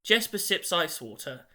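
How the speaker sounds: ordinary delivery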